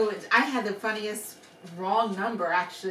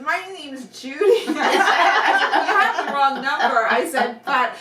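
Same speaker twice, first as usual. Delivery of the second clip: louder, higher, laughing through the words; laughing explanation; 6 semitones higher, 7 dB louder than usual. Retold or imitated. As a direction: tell it kindly while laughing. Laughing retelling to caller.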